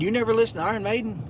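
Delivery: quick mock Danish accent on a surprised question. Telling viewers how his Danish friend quizzed him, in a mock Danish accent. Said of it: "In his Denmark accent".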